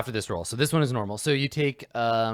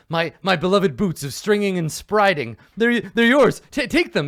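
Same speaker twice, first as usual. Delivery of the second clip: panicked babble, high and loud; Sir Bluto babbling; 8 semitones higher, 9 dB louder than usual. Acted. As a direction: sputter and stammer out a desperate bribe. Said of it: "babbling over his words".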